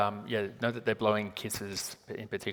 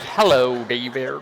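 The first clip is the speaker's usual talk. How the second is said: mock American greeting, louder; imitating American accent; 9 dB louder, much slower than usual. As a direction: echo a guest's accidental American accent. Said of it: "You're like".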